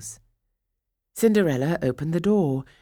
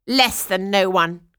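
louder, jeering stepsister voices; scornful stepsisters; 4 semitones higher, 8 dB louder than usual. Acted. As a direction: sneer at her. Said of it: "cried the stepsisters".

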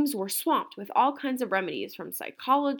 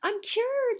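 much higher, louder joyful shout; 4 dB louder than usual. A narrator's reading. Camilla cured of stripes, high joyful shout. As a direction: shout it out in triumph. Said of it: "she shouted".

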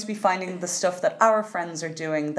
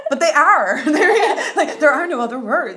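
laughing; 8 semitones higher, 7 dB louder than usual. Real, not acted. Laughing insistence about talented friends.

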